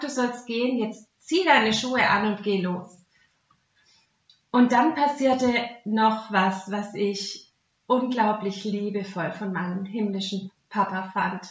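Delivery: ordinary delivery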